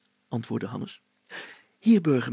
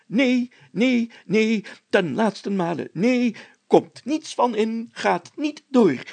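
higher, slow, emphatic no; firm refusal; 7 semitones higher, slower than usual. A narrator's reading. Mayor forbids smashing the box, higher and slow. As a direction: lay down the law word by word.

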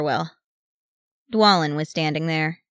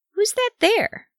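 very high, curious little voice; small child's high-pitched question; wondering child; slower than usual. A narrator's reading. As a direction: pipe up high with wide-eyed wonder. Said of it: "wondered Gimage".

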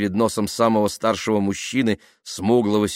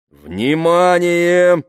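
loud, high, long-drawn command; iron voice; 11 semitones higher, 9 dB louder, much slower than usual. A narrator's reading. Tamara silencing the protesting crowd, iron voice. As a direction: call the crowd to order with iron authority. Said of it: "железным голосом сказала Тамара".

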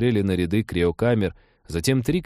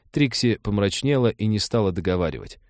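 ordinary delivery

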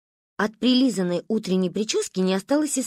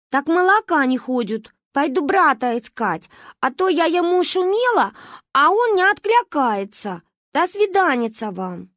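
put-on village girl; 7 semitones higher, 4 dB louder than usual. Varya says goodbye in rustic speech. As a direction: speak like a rustic little girl.

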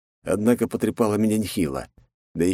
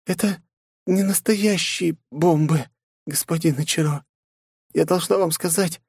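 much higher, weak pleading woman; wounded scientist; 11 semitones higher than usual. A narrator's reading. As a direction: confess and apologise, fading.

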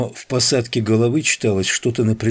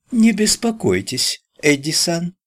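Chinese coolie voice; 9 semitones higher than usual. Acted. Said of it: "Ричи заговорил голосом китайского кули".

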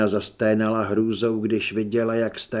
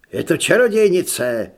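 loud, higher alarmed cry; monk's shout; 6 semitones higher, 8 dB louder than usual. A narrator's reading. Monk shouting to lord about witch, loud alarm. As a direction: shout out the accusation. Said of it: "vykřikl de Sousa".

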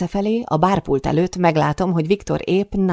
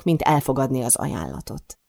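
usual speaking voice

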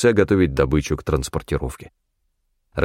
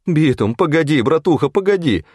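high, whining crony voice; pleading whine; 8 semitones higher than usual. A narrator's reading. Федька begging hunter for his share, whiny plea. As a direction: beg him to wait, whining. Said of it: "умоляюще проскулил Федька".

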